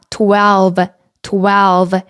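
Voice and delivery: slow "twelve" with a careful final V; V pronunciation demo. Shown as a demonstration. Teacher drilling twelve for learners, careful V pronunciation. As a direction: model "twelve", lip to teeth on the V. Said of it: "또 V 발음 있죠?".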